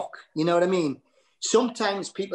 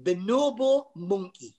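Geordie accent; 9 semitones higher, 3 dB louder than usual. Shown as a demonstration. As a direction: savour bonobo monkey in Geordie. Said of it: "You can't say that in any other language".

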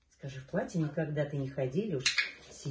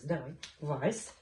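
usual speaking voice

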